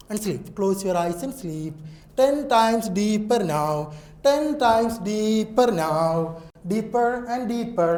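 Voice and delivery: very slow, soft trance voice; hypnotic deepening. Acted. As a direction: draw each word out, lulling. (പതുക്കെ മയക്കുന്ന സ്വരം)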